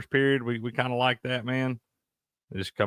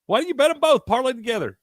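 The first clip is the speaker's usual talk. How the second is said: much higher, louder disbelief; exasperated; 11 semitones higher, 4 dB louder than usual. Real, not acted. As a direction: throw your hands up in disbelief. Exasperated over a missed parlay.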